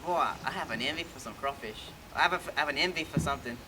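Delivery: put-on Cajun accent. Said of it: "I always said".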